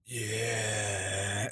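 low, groaning undead yeah; drawn-out zombie moan of a yes. Retold or imitated. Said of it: "in a zombie voice".

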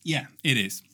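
ordinary talk, nothing marked

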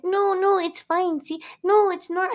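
high, anxious mock soothing turning to alarm; hammed-up panic at the horse. Woman trying to calm a panicking horse in mock alarm. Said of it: "And she's like".

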